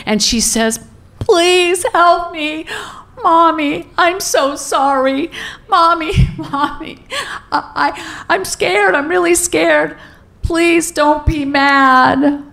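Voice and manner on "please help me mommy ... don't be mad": put-on high, slow, pleading through tears as a scared girl; terrified sobbing child. Twelve-year-old daughter confessing to mom, high terrified sobbing. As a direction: imitate a panicked daughter begging not to be scolded. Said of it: "she's sobbing in terror and full tears".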